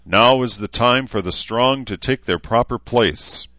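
rally the followers with a speech; rousing speech. A narrator's reading. Leader's rousing speech to his followers.

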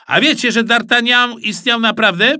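teacher's pet lecturing classmates, high smug voice; know-it-all pupil. Acted. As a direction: show off what he knows. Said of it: "powiedział Ananiasz".

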